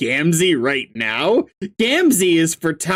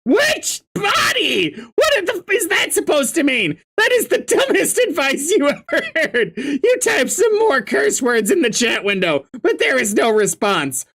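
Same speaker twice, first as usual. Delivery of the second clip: freaked out shout; 9 semitones higher than usual. Acted. A player's character yelling at a cryptic messenger, panicked. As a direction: shriek in disbelief at the advice.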